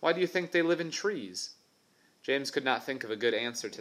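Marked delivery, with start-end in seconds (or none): 0.0-1.5 higher, insistent schoolboy voice; know-it-all boy. Acted. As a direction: argue like a smug boy. Tubby Ted argues back.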